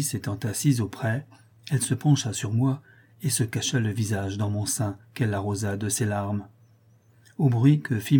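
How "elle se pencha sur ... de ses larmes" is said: ordinary delivery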